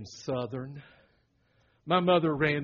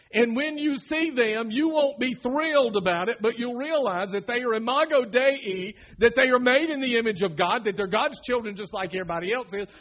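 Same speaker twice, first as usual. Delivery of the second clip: loud, higher full-force preaching; 7 semitones higher, 8 dB louder than usual. Acted. Pastor preaching loudly to the congregation. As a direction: build it like a revival sermon.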